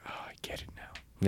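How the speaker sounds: hushed, breathy aha moment; whispered realization. Retold or imitated. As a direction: whisper it like a quiet revelation. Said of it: "you're like".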